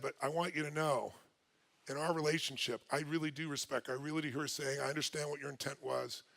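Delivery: low, soft, earnest; sincere reassurance. Retold or imitated. Wife acknowledging his intent, as he retells it, tender.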